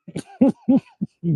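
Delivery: high giggling laugh. Real, not acted. Comic laughing about getting out of water, giggle. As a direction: giggle at your own joke.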